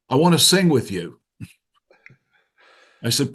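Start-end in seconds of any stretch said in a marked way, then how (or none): 0.1-1.2 higher, louder imitated Brit; mock British accent, raised pitch. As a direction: put on an eager British caller's voice. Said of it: "with a British accent".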